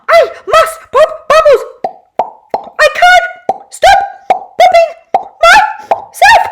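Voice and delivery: very high, loud, giggly manic voice; manic bubble wrap woman. A narrator's reading. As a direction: pop every word like a bubble.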